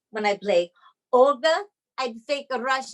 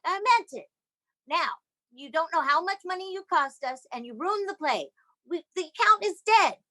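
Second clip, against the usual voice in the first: scolding rant; 7 semitones higher than usual. Retold or imitated. L berating her in the back seat, angry scolding. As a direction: lay into her for ruining the play.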